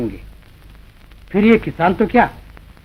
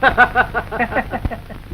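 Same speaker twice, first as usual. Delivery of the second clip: scornful laugh; 4 semitones higher than usual. Acted. Landlord mocks the farmer.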